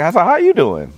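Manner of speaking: high, sing-song imitation of her asking; mock her cheery "how you doing"; mimicking her sweet greeting. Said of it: "she's like".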